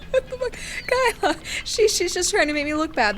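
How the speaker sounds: shrill high panic; panicked high voice. Acted. Yuri panicked, accusing.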